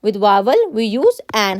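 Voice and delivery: very slow, each word apart; teacher reciting a grammar rule slowly; slow rule recital. Shown as a demonstration. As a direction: dictate the rule slowly to students. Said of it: "हमने अभी पढ़ा है कि".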